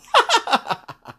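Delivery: shrill burst of laughter; high giggling squeal. Real, not acted. Laughs at own slip.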